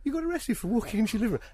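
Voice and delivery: high, fast disbelieving question; incredulous disbelief. Retold or imitated. Telling the host about reacting to the arrest, incredulous and fast. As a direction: sound stunned that he was arrested for this.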